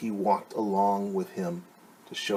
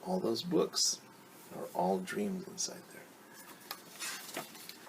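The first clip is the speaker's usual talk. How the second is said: hushed dreamy; 3 semitones lower, 9 dB quieter, much slower than usual. Acted. Dreamy ending of the story.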